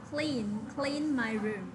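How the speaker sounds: very slow, careful model of the phrase; slow teacher demo pronunciation; slow model reading. Shown as a demonstration. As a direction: spell out "Clean, clean my room" slowly for the class. Teacher models new vocabulary.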